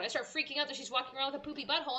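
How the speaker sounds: normal talking voice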